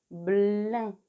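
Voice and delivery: "Blin" loud, firm b and long held l; sounded-out syllable. Shown as a demonstration. Teacher repeats for pupils, "Blin" loud with a long l. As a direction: hold the l of "Blin" after a clear b. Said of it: "Attention, le premier son".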